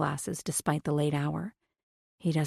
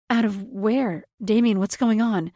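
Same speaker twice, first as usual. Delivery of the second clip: rising panic, louder; edgy, nervous; 5 semitones higher, 5 dB louder than usual. A narrator's reading. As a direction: let the nerves show. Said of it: "the edge in my own voice".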